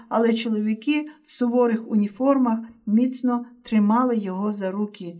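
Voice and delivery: usual speaking voice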